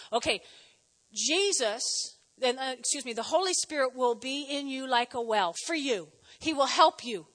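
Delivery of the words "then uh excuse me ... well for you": ordinary delivery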